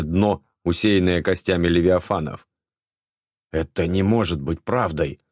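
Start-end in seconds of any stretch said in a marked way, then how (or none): none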